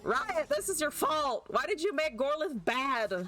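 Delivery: exasperated high-pitched accusation; accusing outburst. Real, not acted. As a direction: blame them in a high wail. Blaming the game's makers.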